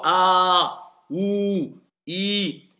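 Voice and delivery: "a", "ou", "i" each held long; long vowels. Shown as a demonstration. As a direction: stretch each of a, ou, i separately. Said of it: "quand il y a une voyelle longue".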